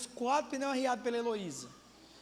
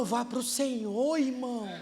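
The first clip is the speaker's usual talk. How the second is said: normal talking voice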